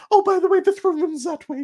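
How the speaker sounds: high, loud princess voice; foppish princess. Acted. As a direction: chirp it like a fluttery princess. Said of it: "she says, as she points".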